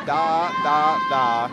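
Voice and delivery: loud, separate das, falling in pitch; quantum mechanics professor. Retold or imitated. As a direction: imitate a professor's deliberate "da, da, da". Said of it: "he would say".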